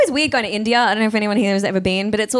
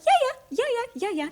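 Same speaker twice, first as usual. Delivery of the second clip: tiny, very high, soft yeses; eager agreement; 6 dB quieter, much faster than usual. Retold or imitated. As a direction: squeak out quick eager yeses quietly. Acting out her own eager replies.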